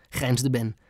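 ordinary delivery, nothing marked

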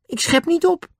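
high-pitched indignant protest. A narrator's reading. Annie answers Dick's charge of boasting, indignant. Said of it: "zei Annie verontwaardigd".

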